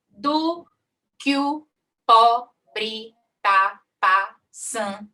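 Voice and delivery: very slow, each syllable pulled apart; syllable count. Shown as a demonstration. Teacher counts syllables aloud for students, drawn out slowly. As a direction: stretch "só Jesus é testemunho" out one poetic syllable at a time. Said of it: "Sete de novo".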